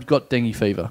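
ordinary talk, nothing marked

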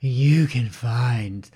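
very slow, sultry radio voice; sexy podcast voice. Shown as a demonstration. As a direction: drag each word out seductively. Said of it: "use your sexy podcast voice".

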